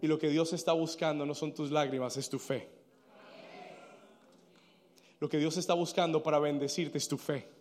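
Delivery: low, soft, sober admonition; quiet grave preaching. Acted. Preacher telling congregation God seeks faith, not tears, quiet and grave. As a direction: bring it right down and say it gravely. Preacher drops after climax.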